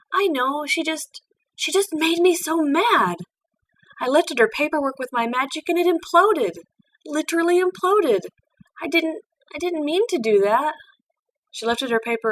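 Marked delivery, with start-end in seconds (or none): 0.1-10.7 higher and louder, heated outburst; young pupil upset at her own temper; Twilight getting angry. Acted. Twilight admits she got mad.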